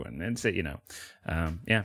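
ordinary delivery

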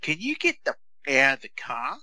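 put-on quoting a rude young teammate, pitched high; a bratty kid demanding you get out; young kid's voice. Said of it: "this young voice came up and said".

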